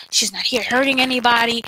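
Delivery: normal talking voice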